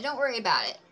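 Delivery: ordinary delivery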